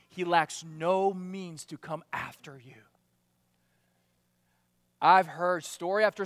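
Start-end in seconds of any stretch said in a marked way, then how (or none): 0.1-2.8 whispered, leaning in close; a hushed aside to the congregation; confiding whisper. Real, not acted. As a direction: drop to a whisper to make it land. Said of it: "trust me".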